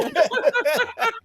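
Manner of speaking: a high, shrieking burst of real laughter. Real, not acted. Co-host cracking up at the story with a high shrieking laugh. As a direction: let a squealing laugh burst out. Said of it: "that is unreal".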